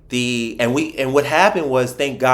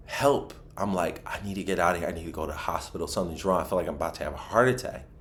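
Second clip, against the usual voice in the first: hushed panic; 6 semitones lower, 10 dB quieter, much faster than usual. Retold or imitated. Panicked plea, retold very quietly.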